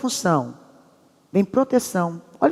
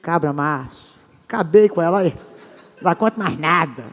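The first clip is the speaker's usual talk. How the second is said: macho husband thinking; 4 semitones lower than usual. Retold or imitated. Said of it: "ele pensa assim".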